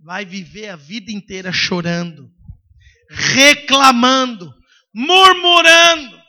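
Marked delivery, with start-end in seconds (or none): 1.5-6.2 loud, drawn-out, rising; slow booming lament list; preached climax. Acted. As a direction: stretch each word out to the congregation.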